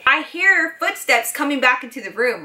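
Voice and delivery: usual speaking voice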